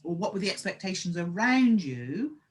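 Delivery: normal talking voice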